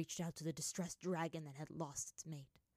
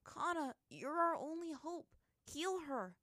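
pleading; 10 semitones higher than usual. A narrator's reading. Aang pleading with healer.

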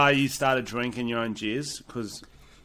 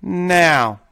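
loud urging; 5 semitones higher, 6 dB louder, much slower than usual. Real, not acted. Urging listeners to skip spoiler.

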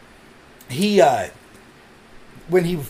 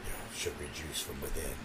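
seductive whisper; 13 semitones lower, 20 dB quieter than usual. Pretend. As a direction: whisper it low and sleazy. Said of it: "It's all about the voice".

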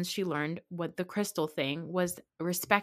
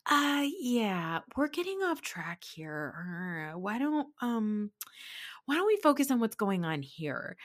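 awkward redirecting; 3 semitones higher than usual. Retold or imitated. Biggest supporter changing the subject, imitating awkward redirecting. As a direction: stall with long hesitations, then steer away.

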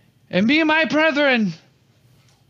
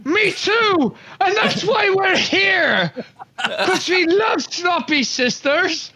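high, drawn-out rallying shout; 5 semitones higher, slower than usual. Acted. Recruit answering a heckler in his speech, rallying shout. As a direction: shout back to the cheering crowd. Player shouts back to the crowd.

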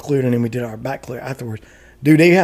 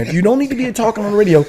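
higher-pitched retold scolding; going off; 7 semitones higher than usual. Retelling his radio rant, mock telling-off. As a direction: re-enact telling people off over the radio. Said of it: "I'm going off".

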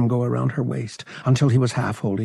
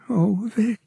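much higher, shaken, near tears; distraught babbling; 12 semitones higher than usual. Acted. Bria distraught, pouring it out to Vic, high and shaken. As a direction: break down as it spills out. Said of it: "she babbled".

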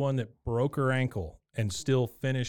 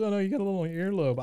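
high, fast, lightly voiced Peggy; high, quick mock doting wife; mock sweet wife; 7 semitones higher, much faster than usual. Retold quote, high and fast.

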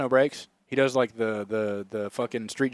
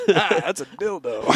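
mock Mark Norman delivery; 4 semitones higher than usual. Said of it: "repeating own quote".

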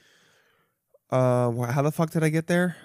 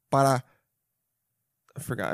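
ordinary talk

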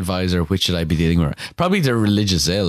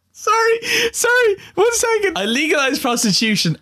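very high, squeaky through laughter; real laughing fit, voice squeezed high; helpless laughter. Real, not acted. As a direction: struggle to speak through giggles.

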